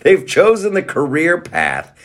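high, slow laughing question. Real, not acted. Vlogger laughing as he mocks actors wanting attention. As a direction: laugh while asking the question.

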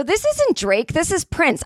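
high-pitched mock yell, no real volume; retold yell across. Customer correcting two young guys across a wine bar, mock yell. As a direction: imitate calling a correction across the room. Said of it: "I yell across".

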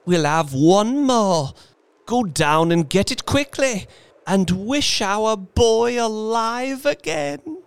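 high, loud desperate urging; frantic urging. Acted. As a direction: urge him on frantically. Wife frantically urging husband.

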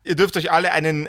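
ordinary delivery

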